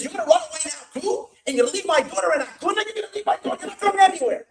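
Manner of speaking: high, loud indignant scolding; acted-out furious disbelief; angry outburst. Retold angry parent confronts runaway.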